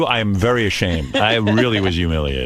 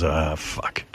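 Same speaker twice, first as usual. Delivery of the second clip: muttered curse; 5 semitones lower, 7 dB quieter than usual. Retold or imitated. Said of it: "I just feel like an annoying asshole".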